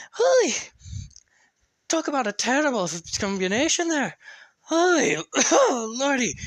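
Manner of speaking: put-on a young tinkerer staggering out of smoke; spluttering alchemist. Maggie coughs after explosion.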